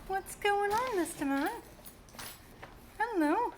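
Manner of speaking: high, sing-song greeting to a pet; cutesy pet talk. Real, not acted. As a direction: coo it up high to the cat. Greeting the pet cat fondly.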